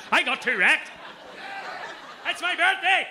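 hammed-up wail of a wasted birthday boy; mock drunk birthday guy.

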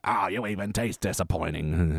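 put-on low, quiet grumbling old woman; mock old lady voice. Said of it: "Agnes with eating Skinner".

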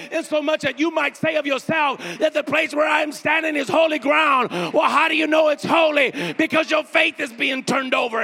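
loud, high preaching; shouting preacher; preaching at full force. Acted. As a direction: preach it out with rising fire.

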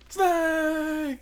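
yelled name, high and loud; yelling a name. Pretend. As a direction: shout his name across the room.

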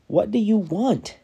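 man shouting down at the stranger; yelling over the edge. Acted. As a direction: yell it down slowly in exasperation. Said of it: "Again he yelled over the edge".

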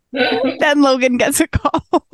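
words squeezed out through high laughter; can barely get it out for laughing. Real, not acted. Recalling the awkward call, real laughter.

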